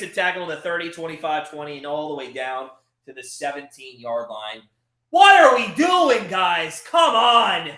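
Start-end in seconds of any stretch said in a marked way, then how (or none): 5.1-7.7 loud, higher shout at the defense; frustrated yell. Real, not acted. Fan yelling at his team after a big play, frustrated shout.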